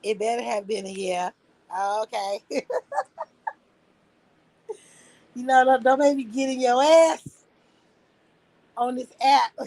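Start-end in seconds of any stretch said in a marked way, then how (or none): 2.4-3.2 squealing high-pitched giggle; playful high squeal of a laugh. Real, not acted. Laugh after "okay. 5.4-9.5 mock threat, higher and louder; joking sassy threat; playful threat.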